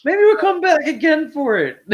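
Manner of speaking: high, loud teasing line; joking tease. Pretend. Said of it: "No, I'm just joking".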